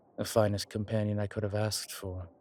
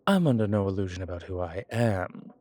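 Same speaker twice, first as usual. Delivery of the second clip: ordinary delivery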